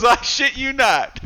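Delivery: high, slow "I shit you not" through laughter; laughing mimicry. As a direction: quote it back while laughing. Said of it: "I love when Gabe goes".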